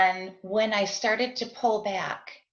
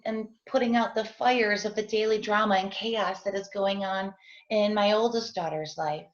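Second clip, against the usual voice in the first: usual speaking voice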